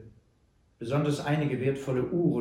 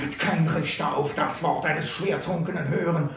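louder, higher scornful outlaw; defiant Butler; 6 semitones higher, 6 dB louder than usual. A narrator's reading. Bound Butler mocks Sam's lack of proof, defiant. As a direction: sneer out a challenge.

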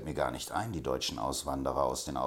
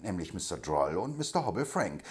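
ordinary delivery, nothing marked

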